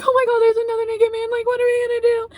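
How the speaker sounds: high, fast mock panic; panicked. Friend tells Tom about another naked man, mock panicked.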